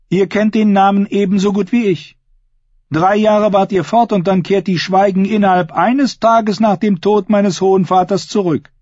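high, heated character voice; sharp accusation. A narrator's reading. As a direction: accuse your uncle, pitch held high. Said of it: "Angesichts der Schärfe in Aschers Stimme". (scharfe, hohe, anklagende Stimme)

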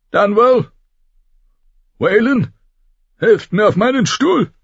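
put-on old lord summoning sons to lift him; commanding old lord. A narrator's reading. Old lord calls his sons.

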